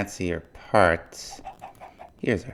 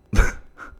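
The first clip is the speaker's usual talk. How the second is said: short laugh; 5 dB louder than usual. Real, not acted. Amused by the battle axe.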